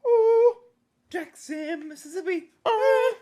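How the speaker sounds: high, loud sing-song announcement. Pretend. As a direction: croon the town name up high. Sing-song reveal of filming place.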